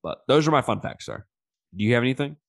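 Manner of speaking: usual speaking voice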